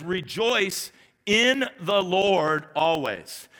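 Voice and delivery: drawn-out, each word weighed; slow it right down on the verse; stretched, solemn verse reading; slow, deliberate reading. Acted. Preacher slowly reads key verse.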